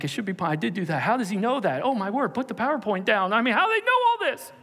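high, fast, flustered panic; panicked defendant. Acted. The accused sinner panicking in court, high and fast. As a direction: blurt it out in rising alarm. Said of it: "And you're like".

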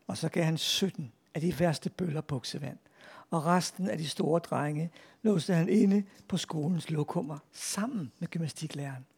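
put-on lively read-aloud for children; story narration. A narrator's reading. Author reads a schoolboy tall tale to an audience, animated storytelling. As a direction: tell a comic tale with animated character quotes.

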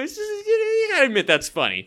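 high, squeaky laugh-talk. Real, not acted. Co-host cracking up at the joke, laughing while talking. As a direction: barely get it out through laughter.

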